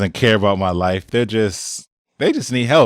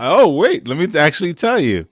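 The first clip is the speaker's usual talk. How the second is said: mock surprised stop; 7 semitones higher than usual. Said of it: "you're not gonna stop".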